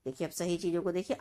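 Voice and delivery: usual speaking voice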